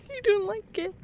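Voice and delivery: high, scared whine. Real, not acted. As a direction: whimper it out. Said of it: "I have a fear".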